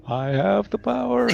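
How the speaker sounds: high, loud hammed-up villain; mock villain voice. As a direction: ham up a power-mad witch. Mocking the player's realization.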